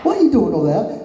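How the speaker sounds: high-pitched, hammed-up English accent; attempted English accent. Shown as a demonstration. Wrestler answering who does the best English accent, attempted English accent. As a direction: put on a loud mock English accent. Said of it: "I don't really do a great accent".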